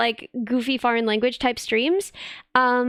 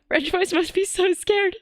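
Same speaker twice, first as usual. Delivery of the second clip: higher, put-on frightened voice; mock scared squeak; 7 semitones higher than usual. Shown as a demonstration. Repeating her own line to chat, now in a higher mock-frightened voice.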